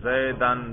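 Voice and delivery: "زیدن" ending in -an; "زیدن" with an a before the final n; accusative ending. Shown as a demonstration. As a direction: say "زیدن" as zaidan. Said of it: "تینوں طرح اعراب اس پر آتے ہیں".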